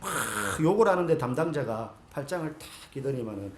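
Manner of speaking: ordinary talk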